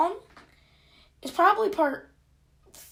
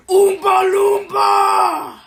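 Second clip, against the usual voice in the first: silly shout; 6 semitones higher, 12 dB louder, much slower than usual. Pretend.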